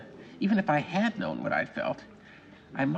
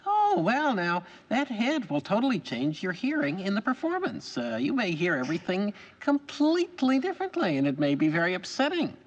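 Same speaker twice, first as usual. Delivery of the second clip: higher-pitched imitation of a fellow actor's veiled dig; politely undermining, pitched up; mock-concerned colleague; 7 semitones higher than usual. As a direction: imitate a colleague's loaded warning. Acting out a fellow actor's hostile remark.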